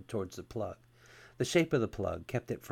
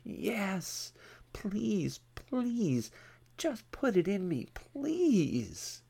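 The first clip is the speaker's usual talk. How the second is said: high, pleading whine stretched on every please; whiny, desperate begging; 11 semitones higher, much slower than usual. A narrator's reading. Captive man begging the officer for the plug, whiny and desperate. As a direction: beg in a thin, desperate whimper. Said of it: "in a whiny, desperate voice".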